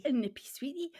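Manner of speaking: breathy whispered repeat, savouring it; hushed mock-Scottish whisper; whispered echo. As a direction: whisper the phrase back softly. Echoing the phrase in a whisper.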